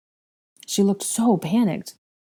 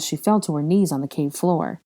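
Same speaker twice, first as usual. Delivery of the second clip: ordinary delivery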